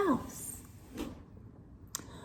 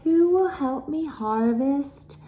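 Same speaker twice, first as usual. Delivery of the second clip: higher voice calling for help; 8 semitones higher than usual. Acted. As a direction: call to the sisters, asking. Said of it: "Tilda called to the others".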